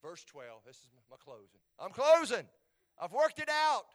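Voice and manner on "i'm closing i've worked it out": high, very loud outburst; shouted, pitch jumping high; sudden preacher's shout. Real, not acted. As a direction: belt it out to the congregation. Mock protest at his closing.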